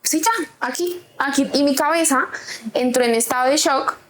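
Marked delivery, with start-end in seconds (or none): 0.0-1.5 mock panic. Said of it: "entraba en pánico y yo era como".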